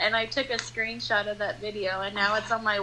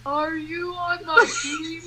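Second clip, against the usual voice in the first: slow-mo voice; 5 semitones higher than usual. Retold or imitated. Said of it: "I see her slow-mo voice".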